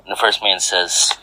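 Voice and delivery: plain reading voice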